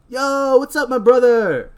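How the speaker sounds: high, loud, drawn-out greeting; hammed-up high-pitched shout. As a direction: holler it like an over-the-top stoner.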